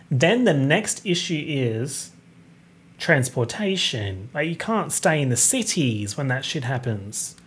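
ordinary talk, nothing marked

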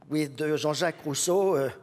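normal talking voice

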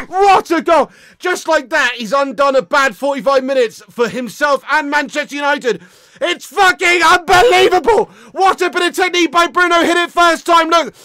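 loud thrilled gushing; real elated fan; excited raving. Real, not acted. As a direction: rave about the goal, still buzzing. Still celebrating goal.

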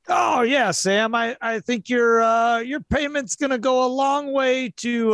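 half-hearted gruff glad-hander. Acted. GM voicing a gruff man thanking Sam on a call. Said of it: "gruff, uh, voice saying".

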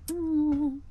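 high cooing ooh at the cat. Real, not acted. Owner cooing at her cute cat in a high voice. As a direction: coo over the cute cat.

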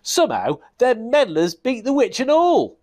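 high, loud rough goblin voice; goblin king. Acted. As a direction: grumble like a sore loser goblin.

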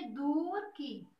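दूर की drawn out very slowly, syllable by syllable; teacher slowly sounding out दूर की; slow sound-alike demo. Shown as a demonstration. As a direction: stretch दूर की long to echo Turki. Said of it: "तुर्की से क्या tone निकली है?".

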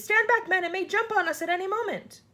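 high, loud, urgent order; yelled warning. A narrator's reading. Police chief warning his men of attack, yelling. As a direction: warn your men off at full voice. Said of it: "yelled the f-, chief of police".

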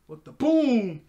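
loud, high, excited blurt; amazed outburst. Real, not acted. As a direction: burst out in amazement. Amazed reaction to surprise attack.

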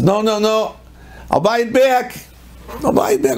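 high-pitched acted panic; frantic pleading. Husband begging his wife not to leave, frantic. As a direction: blurt it out high, desperate to undo it.

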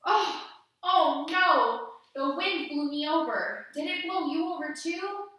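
playful cry of falling over; mock dismay. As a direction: pretend you just fell over, hammed-up. Tree blown over, pretend dismay.

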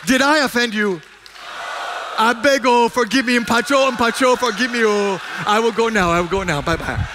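loud, high sermon delivery, driving each question home; fervent preaching. Acted. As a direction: preach it at full force to the congregation. Preaching with jokes.

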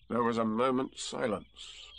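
ordinary delivery

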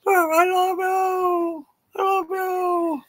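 very high, slow crow shaped into words; rooster imitating kids. Rooster repeating what the kids said, crowing imitation. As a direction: crow the words, each phrase held long and high. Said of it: "he's doing is imitating".

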